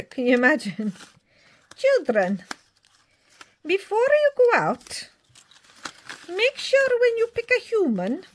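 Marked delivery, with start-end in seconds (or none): 1.8-8.2 put-on much higher and louder, in a made-up Scottish accent; a silly, squeaky Scottish mix voice addressing children; weird Scottish accent. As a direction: play a daft Scottish-ish character warning the kids. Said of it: "kind of a weird Scottish mix thing".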